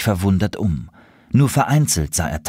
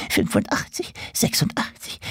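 whispering the numbers; whispered counting; 7 semitones higher, 5 dB quieter than usual. Acted. Squirrel counting its hidden nuts in a whisper. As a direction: count under your breath.

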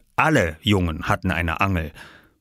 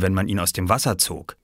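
usual speaking voice

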